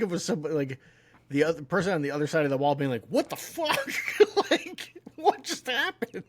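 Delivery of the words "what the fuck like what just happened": high, laughing "what the fuck"; mock shocked outburst. Neighbour reacts as a fist bursts through the wall, high mock shock. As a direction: play the startled neighbour, half laughing.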